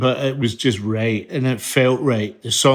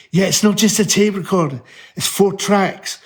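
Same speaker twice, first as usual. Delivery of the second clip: higher-pitched, earnest pleading pitch; eager justifying; 5 semitones higher than usual. Retold or imitated. Sons defending the four-track to dad, eagerly justifying. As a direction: plead your case, voice lifted.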